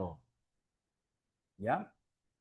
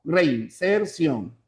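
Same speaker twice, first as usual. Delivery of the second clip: "Reinserción" slowly split into rein-ser-ción; syllable by syllable; much slower than usual. Shown as a demonstration. Teacher repeating the count for students, "Reinserción" slowly syllable by syllable. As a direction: separate each syllable of "Reinserción". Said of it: "presente cuatro sílabas".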